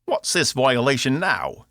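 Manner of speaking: high, indignant Cockney robot. Acted. Box objects to Cass opening his port, indignant Cockney. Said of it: "he said in his Cockney voice".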